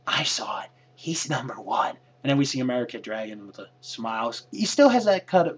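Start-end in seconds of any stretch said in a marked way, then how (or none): 0.0-2.0 whispered secret; imitating a hushed whisper. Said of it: "she's like".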